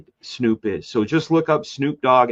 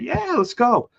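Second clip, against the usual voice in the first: much higher, keen yes; eager; 13 semitones higher than usual. Retold or imitated. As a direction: jump at the offer. Retold eager agreement.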